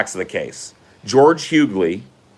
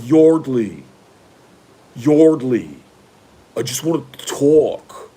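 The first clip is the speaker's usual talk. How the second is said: put-on higher, drawn-out entitled rich boy plea; smug rich boy voice, pitched up; imitating George Hughley; 4 semitones higher, slower than usual. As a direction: play the privileged college boy coaxing her calmly. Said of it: "I'm doing a very good impression of George Hughley".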